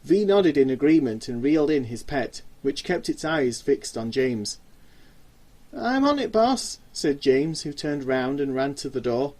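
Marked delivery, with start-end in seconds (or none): 5.7-6.8 nervous eagerness. Acted. Eager scared henchman.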